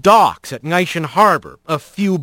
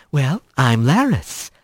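flirty rat; 5 semitones lower, slower than usual. A narrator's reading. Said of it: "said the slender rat".

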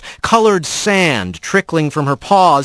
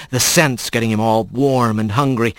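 ordinary delivery, nothing marked

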